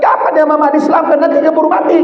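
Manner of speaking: high, eager mother's voice; mother's urgent question. Retold or imitated. Dying mother presses son about converting, high and eager. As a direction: urge it like she fears running out of time.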